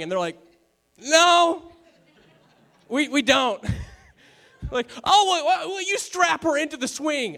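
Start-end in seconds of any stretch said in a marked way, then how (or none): 1.0-3.6 high, loud drawn-out no; imitating a couple's awkward admission; mock emphatic denial. Acted parents' denial, high and loud. 5.0-7.4 high-pitched incredulous questioning; mock alarm. Acted alarmed friend grasping for reassurance.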